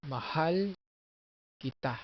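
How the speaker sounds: mahal kita as ma-HAL, ki-TA, with a pause between; "mahal kita" stressed on both final syllables; stress on last syllables. Shown as a demonstration. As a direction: raise and hold HAL, then land on TA. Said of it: "You accent".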